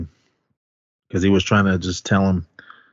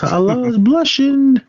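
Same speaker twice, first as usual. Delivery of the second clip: mock insult; 13 semitones higher than usual. Retold prison quarrel, Big Al.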